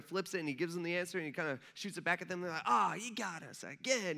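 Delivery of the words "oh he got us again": slow, drawn-out mock groan of defeat; mock exasperation. Religious leaders beaten by Jesus, mock exasperation. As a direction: groan as if outwitted, hammed up.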